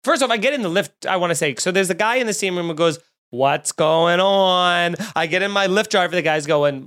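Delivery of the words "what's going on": very slow, stretched-out words; drawn-out greeting. Retold or imitated. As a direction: imitate an oddly drawled-out question. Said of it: "talks to you like that".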